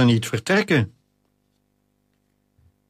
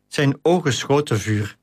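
plain reading voice

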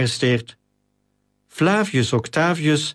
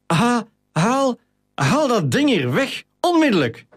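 high, stammering order, much higher; faltering command; 10 semitones higher than usual. A narrator's reading. Captain fallen on his back orders the lion away, stammering in fright. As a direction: try to command but the voice keeps catching. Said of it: "maar met een haperende stem".

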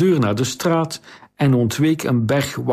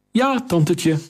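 put-on cheeky boy; 7 semitones higher than usual. Boy teases the queen.

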